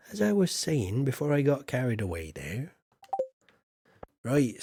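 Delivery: ordinary talk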